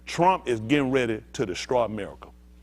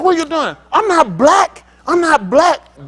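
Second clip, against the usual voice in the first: much higher caricature of an entitled passenger; 13 semitones higher, 8 dB louder, slower than usual. Retold or imitated. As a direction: squeal it like an outraged traveler.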